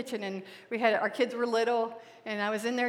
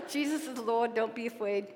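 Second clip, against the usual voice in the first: soft, moved, laughing through tears; remembering her daughter's words softly; tender; 5 dB quieter than usual. Real, not acted. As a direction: hush it with emotion. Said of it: "I always hear".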